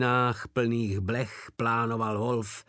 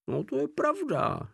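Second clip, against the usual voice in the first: much higher, breezy offhand agreement; nonchalant. A narrator's reading. Porta admitting he knew about the fleas, nonchalant. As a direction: shrug it off. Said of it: "nonšalantně přisvědčil Porta".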